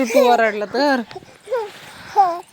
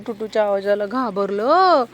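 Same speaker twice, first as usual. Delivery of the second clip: normal talking voice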